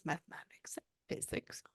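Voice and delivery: a soft whisper, like a secret from beyond. Retold or imitated. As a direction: breathe the words out barely voiced. Medium relays grandmother's words.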